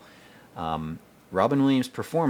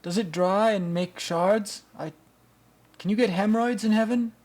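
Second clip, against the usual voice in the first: much higher, exaggerated mocking voice; parodying a movie character, pitched way up; 12 semitones higher than usual. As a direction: squeak out a whiny comic complaint. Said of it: "He's like".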